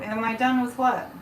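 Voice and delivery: ordinary talk, nothing marked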